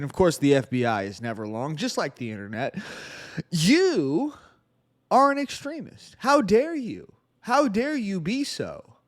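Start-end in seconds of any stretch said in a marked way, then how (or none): 3.5-9.0 high-pitched mock outrage; facetious scolding, pitched way up; sarcastic. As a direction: act scandalized, as a joke. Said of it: "I'm being sarcastic".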